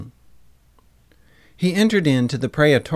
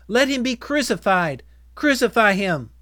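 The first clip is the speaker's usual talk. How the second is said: higher-pitched, insistent cry; crowd demanding; 7 semitones higher, 4 dB louder than usual. A narrator's reading. Crowd answers Pilate about Jesus, insistent calling out. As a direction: call it out as a demanding mob.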